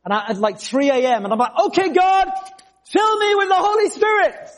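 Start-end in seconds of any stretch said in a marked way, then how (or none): 1.7-4.5 high-pitched re-enacted cry to God; retold shout. Teenager in a field calling on God, shouting. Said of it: "I wanted to shout a little bit".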